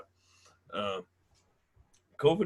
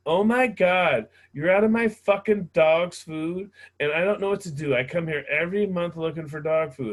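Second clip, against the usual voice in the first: Karen; 5 semitones higher, 5 dB louder than usual. Retold or imitated. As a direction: play the furious Karen over dog food. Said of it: "a Karen coming in".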